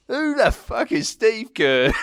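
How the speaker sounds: put-on drawn-out, high-pitched English accent. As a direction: imitate a baffled English stranger, slowly. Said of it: "in an English accent".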